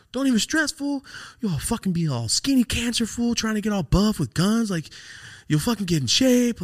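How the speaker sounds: high, loud pep talk; hyping him up. Retold or imitated. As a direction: pump up your friend at full pitch. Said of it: "I'm just trying to, like, hype him".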